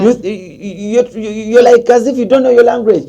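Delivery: high, stammering retold outrage; mock indignant scolding. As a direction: splutter it loudly, as an imitated accuser. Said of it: "they start saying".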